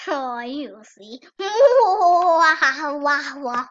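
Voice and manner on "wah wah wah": hammed-up evil cackle; sneering panto villain. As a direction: cackle like a cartoon baddie. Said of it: "you have to work on that evil laugh of yours".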